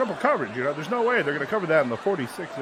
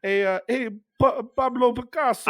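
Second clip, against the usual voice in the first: put-on Transylvanian accent; 8 semitones higher than usual. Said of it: "I'll do Transylvanian too".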